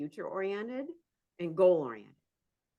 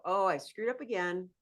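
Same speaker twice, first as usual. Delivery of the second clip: higher, louder mock cheer rising to a squeal; cheerful self-talk; 6 semitones higher, 5 dB louder than usual. A recovering perfectionist cheering her own repair, bright mock cheer. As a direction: celebrate the mistake gleefully. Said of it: "Recovering perfectionists go".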